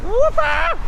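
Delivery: very high, delighted whoop; whoop of joy. Real, not acted. Cyclist celebrating the Greek border, whooping very high. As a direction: let out a triumphant whoop.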